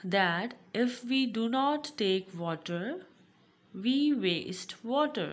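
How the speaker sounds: loud, slow classroom reading; read-aloud lesson. Acted. Teacher reading about wasting water to pupils, read-aloud. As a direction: project each phrase clearly for the class.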